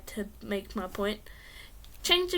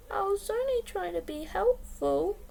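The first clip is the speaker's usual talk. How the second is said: sad voice; 8 semitones higher, 4 dB quieter than usual. Acted. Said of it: "I added in a sad voice".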